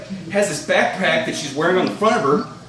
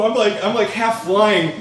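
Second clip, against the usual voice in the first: ordinary talk, nothing marked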